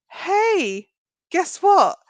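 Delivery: high, loud, drawing attention; excited announcing. Shown as a demonstration. Coach showing how to brag about wins, excited announcing. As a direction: call out big news, pitched right up.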